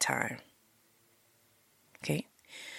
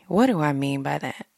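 ordinary talk

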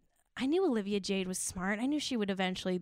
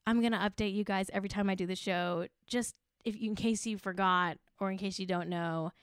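ordinary talk, nothing marked

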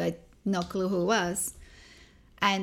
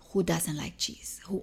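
whispered disbelief; 2 semitones lower, 4 dB quieter than usual. Retold or imitated. Cashier baffled by a no-cheese order, whispered. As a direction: breathe it like a secret outrage.

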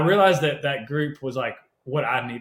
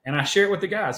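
usual speaking voice

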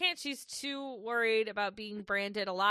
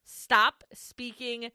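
a touch louder, very slow, snapping at the screen; mock outraged snap; 6 dB louder, much slower than usual. Real, not acted. Viewer yelling at a creepy contestant on TV, in a slow mock-angry snap. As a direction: spit each word out, fed up.

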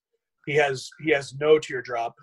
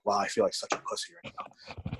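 whispered thought; 5 semitones lower, 8 dB quieter than usual. Retold or imitated. Watching a huge lifter, he whispers his thought. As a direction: lower and softer, a breathy self-deprecating whisper. Retold inner thought in the gym.

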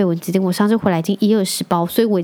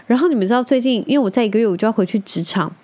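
normal talking voice

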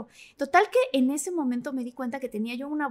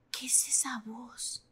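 slow, hushed whisper of surprise; startled whisper; 11 dB quieter, much slower than usual. Retold or imitated. Standing frozen at the sink, hearing the voice: slow whisper. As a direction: breathe it out, frozen in place.

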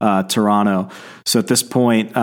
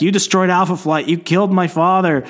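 mock-angry, higher-pitched accusation; retold outrage, pitched up; acted accusation; 7 semitones higher than usual. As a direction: accuse them in a raised, dramatic pitch. Acted accusation from an enraged daughter.

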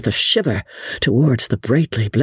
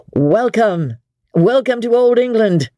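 high, effusive welcome; ringing, high-pitched hearty greeting; 10 semitones higher than usual. A narrator's reading. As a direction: greet guests with open-armed effusiveness. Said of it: "said Lord Antony effusively".

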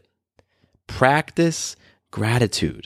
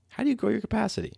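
much higher, softer and faster repeat; repeated question; 11 semitones higher, 9 dB quieter, faster than usual. Shown as a demonstration. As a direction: toss the question back up high and light.

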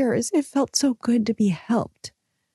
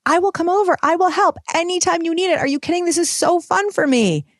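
eager friend's offer; 9 semitones higher than usual. Retold or imitated. Friend Stacy insisting she loves helping, eagerly gushing. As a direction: gush like you can't wait to help.

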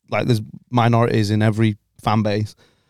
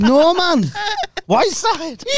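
put-on much higher, broad Geordie accent; much slower than usual. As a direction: really lean into the Geordie. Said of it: "that was a Geordie accent".

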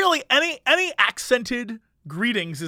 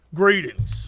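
stiff greeting; 3 semitones lower than usual. Retold or imitated. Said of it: "no one says it like that".

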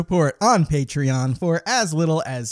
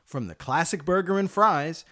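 soft, smooth commercial voice; ad read; 6 dB quieter than usual. Acted. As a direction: sell lunch like a TV spot. Mock lunch commercial read.

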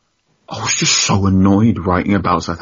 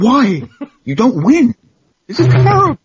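much higher and louder, a rising comic outburst; exasperated disbelief; 7 dB louder than usual. Retold or imitated. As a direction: shriek it like a baffled new fan. Incredulous reply to Spurs friend.

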